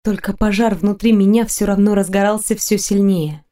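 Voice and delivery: ordinary delivery, nothing marked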